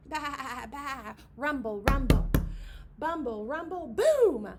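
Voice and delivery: loud rumbling boom; booming rockfall. Acted. Rocks thundering down to the meadow, booming loud.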